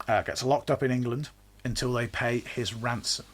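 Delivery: ordinary talk, nothing marked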